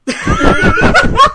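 loud laugh bursting out; loud burst of laughter. Real, not acted. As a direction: let out a big loud laugh. Big laugh at furry remark.

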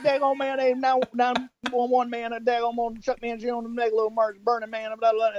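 high, slurred drawling Texan mumble; Boomhauer impression. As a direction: run the words together like Boomhauer on the phone. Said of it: "he's like".

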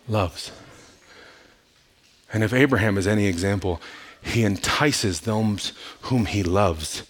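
low, soft, with long pauses; quiet, measured preaching; hushed sermon close. Real, not acted. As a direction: bring the room down gently, phrase by phrase. Sermon winding down before worship.

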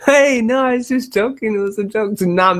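high, urgent pleading; high pleading panic. Real, not acted. Host calling after leaving co-host, high pleading. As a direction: call her back, half laughing.

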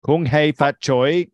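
slow, sung-out Kung Hei Fat Choi; festive greeting. Real, not acted. Chinese New Year greeting, drawn out.

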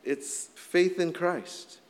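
slow, weighing each word; preacher repeating it slowly for weight; slow emphasis. Acted. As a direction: stretch it out to land the point. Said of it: "That's it".

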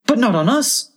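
high, alarmed little oyster cry. A narrator's reading. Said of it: "the oysters cried".

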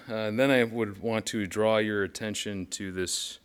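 ordinary delivery

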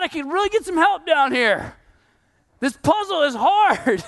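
high, strained complaint to God, half laughing; mock whining prayer. A believer grumbling to God about the hard puzzle, in a mock whine. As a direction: whine like someone overworked and fed up.

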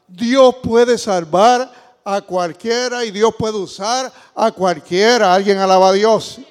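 slow, loud, drawn-out preaching; full-voiced sermon cry; fiery preaching. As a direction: proclaim it to the congregation.

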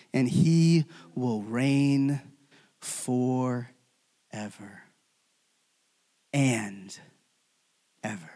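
very slow, low and fading; weighty scripture, sinking almost to nothing; solemn proclamation. Acted. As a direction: let long pauses fall between the last words. Solemn climax of scripture reading.

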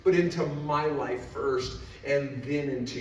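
high, loud, slow-stretched pleas to God; impassioned preaching prayer. Real, not acted. Preacher begging Jesus to change the room, impassioned shouting. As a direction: build to full-force cries, punching each plea.

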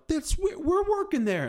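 high-pitched mock quote; mock protest. Mimics own excitable reply in story.

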